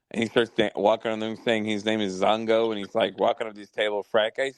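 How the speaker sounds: ordinary talk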